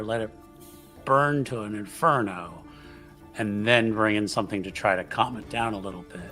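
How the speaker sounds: ordinary delivery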